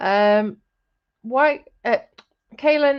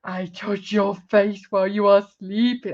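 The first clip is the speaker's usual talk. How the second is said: lower, creepy horror-story voice; creepy imitation; 4 semitones lower than usual. Streamer mocking partner's line about sleeping, creepy. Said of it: "really creepy and horror story like".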